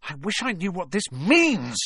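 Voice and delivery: frustrated, bursting out; angry outburst. Acted. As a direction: blurt it out in frustration. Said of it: "he burst out angrily".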